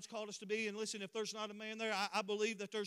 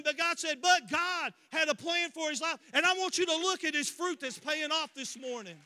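high, loud revival preaching; full-force preaching; 6 semitones higher, 7 dB louder than usual. Real, not acted. Preacher testifying to the congregation about healings, full-force preaching. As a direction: drive it out loud and high, hammering each phrase.

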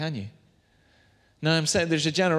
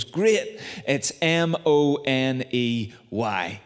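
punchline reveal; much slower than usual. Retold or imitated. Friend reveals the sermon topic, slow spelled-out punchline. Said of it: "And then he goes".

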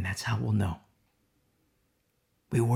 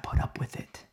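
whisper; 8 dB quieter than usual. Acted. Preacher warns congregation.

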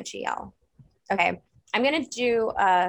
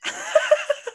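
banshee shriek; 6 dB louder than usual. Shown as a demonstration. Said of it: "the shriek would be like".

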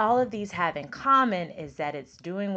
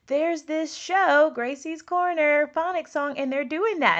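much higher and slow, eager discovery; excited dad; 9 semitones higher, 5 dB louder than usual. Retold or imitated. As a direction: share the find like the eager dad. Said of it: "he was like".

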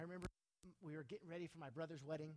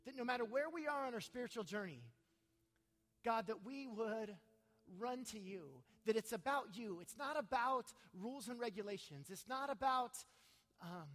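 prayer; 4 semitones higher, 6 dB louder than usual. Acted. Pastor prays over the congregation.

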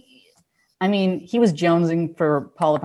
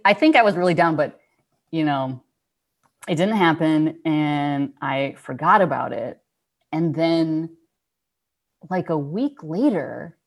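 normal talking voice

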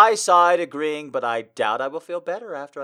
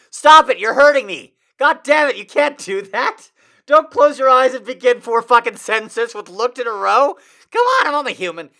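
loud, high hammed-up protest; mock anguished yell; 12 semitones higher, 12 dB louder than usual. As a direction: cry out as if wounded by the prose. Mock pained outburst at text.

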